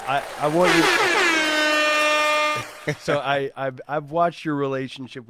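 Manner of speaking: loud, laughing burst of praise; loud cheerful shout-out with a laugh in it; excited shout-out. Real, not acted. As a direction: call it out big to the room. Shout-out to host's wife.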